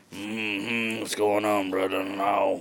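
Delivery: lightly voiced quiet mm-hmm drawl; imitating uncle's drawl. Retelling how his dad's ex-brother-in-law talks, a soft mock drawl. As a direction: mumble it like the ex-uncle. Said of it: "He literally just goes".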